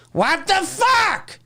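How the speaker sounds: high, slow incredulous what the fuck; mock outraged fan. Streamer mimicking baffled fans, high and drawn. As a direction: drag it out in disbelief.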